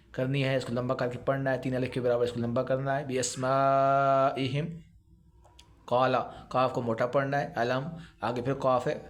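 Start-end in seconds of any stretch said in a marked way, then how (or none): 3.1-4.7 بی اسمائہم slow, with a hissing س and the میم stretched; whistled seen, long meem. Shown as a demonstration. Teacher modelling a whistled seen for students, slow. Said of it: "سین کو سیٹی کی آواز نکالنی ہے اور میم کو پھر مد".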